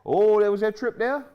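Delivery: sudden loud high shout; shouting at the screen. Real, not acted. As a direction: yell out at the foul.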